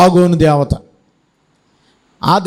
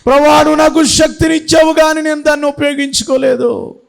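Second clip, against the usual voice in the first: crying out to God; 8 semitones higher than usual. Acted. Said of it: "ఏడుస్తున్నాడు".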